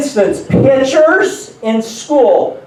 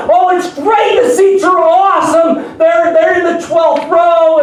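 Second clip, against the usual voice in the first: gushing wife; 8 semitones higher than usual. Retold or imitated. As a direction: gush about the seats like an excited wife. Wife gushing about tickets.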